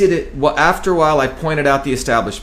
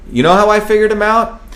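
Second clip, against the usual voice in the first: pitched up, louder, building the question; rising emphasis; 6 semitones higher, 4 dB louder than usual. Real, not acted. Telling the room how he saw through Kennedy, excited. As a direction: tease the reveal with energy.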